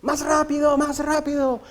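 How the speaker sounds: high, loud frantic cry to the driver; desperate maiden shouting. Retold or imitated. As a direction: shout it like a terrified young woman in a runaway coach. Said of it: "una joven que le gritaba al cochero". (doncella desesperada gritándole al cochero, aguda y fuerte)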